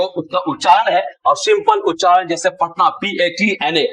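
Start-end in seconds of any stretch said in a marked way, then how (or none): none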